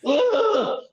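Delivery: high hammed-up groan of pain; mock groan. Co-host mocking the player's fall with a high fake groan. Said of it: "Then she falls down like".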